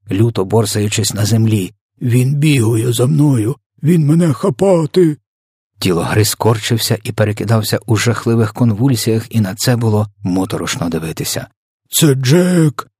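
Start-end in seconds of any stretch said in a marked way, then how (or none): 2.0-5.2 high wail climbing in panic; slow, stretched cry of a terrified giant; screeching. A narrator's reading. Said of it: "верещав тілогриз". 11.9-12.9 high, panicked yell; wailing. A narrator's reading. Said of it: "лементував тілогриз".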